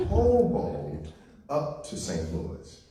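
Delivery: Hoboed up to Saint Louis said slow, high and loud; emphatic repeat. Shown as a demonstration. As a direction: drive home hoboed, slowly. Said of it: "say it one more time".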